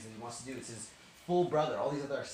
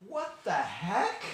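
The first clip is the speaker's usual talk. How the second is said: slow, high, loud astonishment; baffled disbelief; 6 semitones higher, 5 dB louder, much slower than usual. Acted. Brothers puzzled by the seating order, baffled disbelief. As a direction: draw it out in mock bewilderment.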